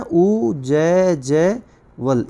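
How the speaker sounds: उ जय जय वल very slow, each part apart; उ, जय, जय, वल, drawn out; slow spelling. Shown as a demonstration. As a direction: spell उज्ज्वल out syllable by syllable. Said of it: "जैसे उज्ज्वल लिखते हैं ना".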